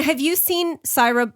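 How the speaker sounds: ordinary talk, nothing marked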